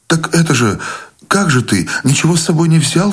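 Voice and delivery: high-pitched, flustered protest; startled fussing. A narrator's reading. Wife worried her husband takes nothing along, startled fussing. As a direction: fret at him, pitched well up. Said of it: "встрепенулась Анеля".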